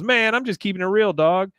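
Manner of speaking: high cocky mock Bobby; imitating Bobby. Bobby acting cool to his dad in a high voice. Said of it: "He goes".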